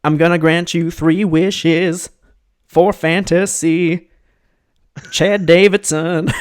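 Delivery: slow, high grand genie. Pretend. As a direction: intone wishes like a genie. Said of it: "I was gonna do a stoic genie voice".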